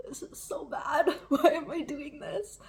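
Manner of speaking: laughing through pain. Real, not acted. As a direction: laugh helplessly at the burn. Pain of spicy pepper, laughing.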